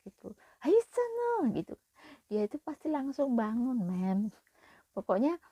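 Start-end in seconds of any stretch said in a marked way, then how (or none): 0.6-1.5 high, loud wake-up call; shout to wake. Shown as a demonstration. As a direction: yell the kid out of bed. Demonstrating how to wake child. (teriakan membangunkan anak)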